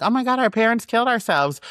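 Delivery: high-pitched mock dismay; put-on, high-voiced alarm; feigned shock. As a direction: pretend to be a horrified innocent child. Kids faking shock over parents.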